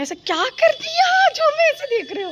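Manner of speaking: high, loud, tearful mock outcry; dramatic crying shout. A vlogger opens with a melodramatic tearful shout. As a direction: wail it like a wronged heroine in a melodrama.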